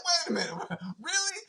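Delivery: high, soft, laughing really; disbelieving laugh at the taunt; laughing disbelief. Retold or imitated. Rookie laughing in disbelief at Favre, high and soft. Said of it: "I was like".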